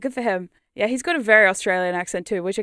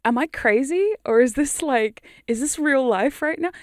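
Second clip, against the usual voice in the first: higher-pitched, baffled questioning; acted disbelief; 4 semitones higher, 4 dB louder than usual. Hypnosis subject doubts what is real, baffled. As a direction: question reality in mild bewilderment. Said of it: "hypnosis that makes you go".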